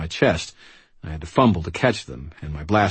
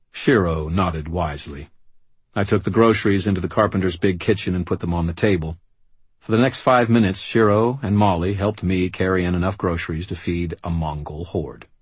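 plain reading voice

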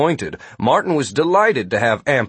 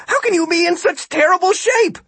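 high-pitched, loud exasperated disbelief; incredulous; 4 dB louder than usual. A narrator's reading. Martin berates the muscular guard for being unfit, incredulous. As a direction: berate them in amazement. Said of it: "Martin couldn't believe it".

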